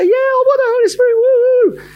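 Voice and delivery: high, loud hammed-up cheer; a mock enthusiastic whoop; over-the-top excited. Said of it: "to say".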